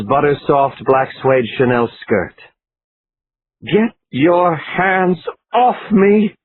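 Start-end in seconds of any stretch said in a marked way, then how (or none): 3.6-6.3 slow, high shrieking protest; screamed. A narrator's reading. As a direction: scream it at her, dragging each word. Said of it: "Laura Winston screamed".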